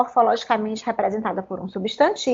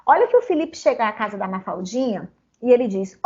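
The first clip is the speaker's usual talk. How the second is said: ordinary talk, nothing marked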